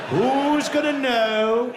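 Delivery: loud, high, drawn-out tempting voice; psychopathic thought. Acted. Psychopathic urge on the train platform, gleeful temptation. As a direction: stretch it out slowly, high and loud. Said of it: "Sometimes the psychopathic one".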